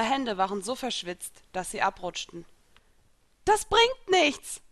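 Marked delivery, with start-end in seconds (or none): 3.4-4.6 much higher, louder and slow; panicked wail while slipping off a cliff; panicked cry. Acted. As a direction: drag the words out in desperate fear. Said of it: "rief ich panisch".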